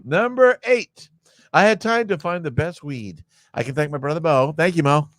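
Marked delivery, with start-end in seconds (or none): 0.0-1.1 slow, higher "Number eight"; drawn-out, raised "Number eight"; announcer countdown. Acted. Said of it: "announcing list item".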